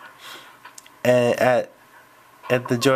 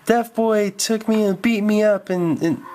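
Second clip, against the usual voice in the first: high, whining complaint; whiny tattling; 7 semitones higher than usual. Acted. Roy complains to the math teacher, whining. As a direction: tattle to the teacher like a scared kid. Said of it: "He said".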